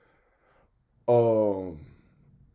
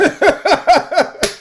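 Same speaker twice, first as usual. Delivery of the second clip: high, loud burst of laughter; real helpless laughter; 6 dB louder than usual. Real, not acted. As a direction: crack up at the chaos.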